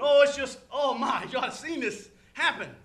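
acted gushing over a thrill; really excited. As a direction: act thrilled to have witnessed it. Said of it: "I can get really excited".

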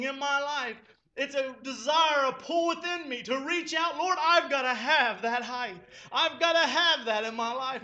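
loud, rising prayer at full preaching force; impassioned pulpit prayer; fervent preaching. Acted. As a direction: cry out the prayer to the Lord.

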